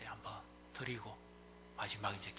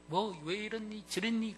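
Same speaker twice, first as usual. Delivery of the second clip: high, slow nagging reproach; mock nagging; 10 semitones higher, 4 dB louder than usual. Mimicking someone digging up faults. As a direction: poke at faults in a higher voice.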